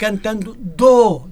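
"do" stretched loud as its own word; very slow, loud "cantando", isolating "do"; exaggerated "do". Shown as a demonstration. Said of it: "Estou a exagerar".

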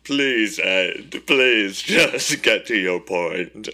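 high, loud hammed-up begging; mock pleading. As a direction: beg the villain, overacted. Victim pleading with villain.

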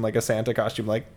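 normal talking voice

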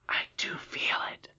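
breathless whispered exclamation; awed whisper. A narrator's reading. Irene touching the invisible thread, in an awed whisper. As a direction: hush it out in amazement. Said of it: "exclaimed the princess".

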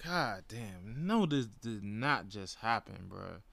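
low, held-in disbelief; retold disgust. Fan stunned by the walk-off loss, quietly pissed. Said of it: "I was pissed".

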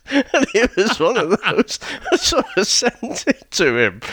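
high, laughing through the words. Real, not acted. Telling of the email sent to his best mate, laughing. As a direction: tell it while cracking up. Cracking up at own prank story.

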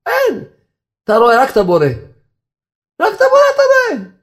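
very high, emphatic cry; impassioned exclamation. Real, not acted. Rabbi insisting only the Creator is seen, impassioned cry. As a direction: drive it home at top pitch.

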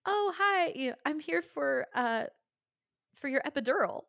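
breezy high-pitched hello from an oblivious doctor; cheery doctor. Retold or imitated. Wrong-room doctor greets nursing mother, high and cheery. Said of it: "he looks at me and he goes".

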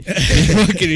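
laughing imitation. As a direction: crack up while repeating a friend's crazy accent. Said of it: "He just has a crazy accent".

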